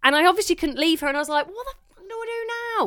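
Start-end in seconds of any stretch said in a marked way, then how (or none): 1.5-2.9 high, softer panicked squeal; high-pitched mock despair; acted panic. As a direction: squeal it in helpless dismay. Acted panic at wrong order.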